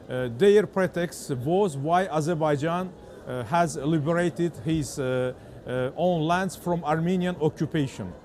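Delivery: English with a strong Azerbaijani accent. Real, not acted. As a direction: speak English the way a Baku reporter does. Interviewer asks about France.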